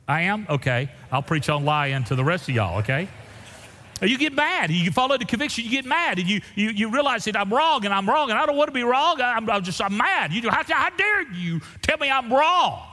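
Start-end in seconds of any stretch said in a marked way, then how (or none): 4.0-12.9 high, driving preacher's voice; fiery preaching. Acted. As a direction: preach it hard and fast. Preaching at full force.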